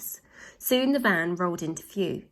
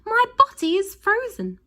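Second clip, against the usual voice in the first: very high, loud, panicked wail; frozen panic; 6 dB louder than usual. A narrator's reading. Missus Glutton panics, buried under ice cream.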